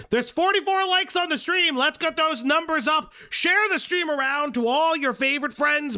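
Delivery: high, insistent streamer push; hype call-out. Real, not acted. Streamer urging chat to share the stream, hyped-up.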